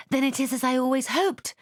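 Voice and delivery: high, bright hopeful exclamation; excited cry. Acted. Jane interrupting the letter, excited cry. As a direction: burst out in eager hope. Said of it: "cried Jane".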